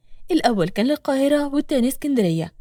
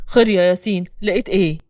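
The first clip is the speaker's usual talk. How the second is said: low, firm man's voice, slightly louder; tense man; 5 semitones lower, 4 dB louder than usual. A narrator's reading. Reda anxiously asks Yassin for news, low tense voice. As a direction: drop the pitch and press the question. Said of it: "رضا اللي واقف على أعصابه".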